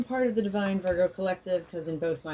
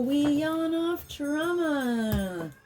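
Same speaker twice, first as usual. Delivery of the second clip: high, slow sing-song; sing-song catchphrase; 8 semitones higher, much slower than usual. Acted. Host stating her channel motto in high sing-song.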